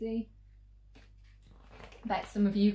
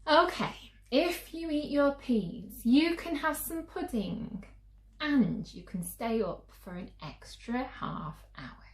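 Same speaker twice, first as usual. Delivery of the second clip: a higher, bargaining mother's voice; coaxing mum; 6 semitones higher than usual. Acted. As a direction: sweeten the deal for a fussy child.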